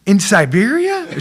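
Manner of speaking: high, loud, slow retold astonishment; incredulous question. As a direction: act out disbelief, drawn out and loud. Reenacting his astonished reply.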